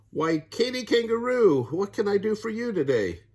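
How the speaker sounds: high, bright-pitched crocodile greeting; a sweet, eager Missus Crocodile. Acted. Crocodile voiced in story.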